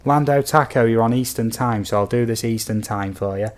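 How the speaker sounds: ordinary talk, nothing marked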